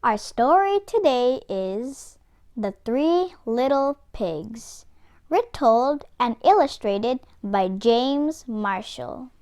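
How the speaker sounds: ordinary delivery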